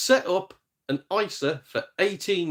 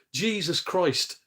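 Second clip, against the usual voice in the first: ordinary delivery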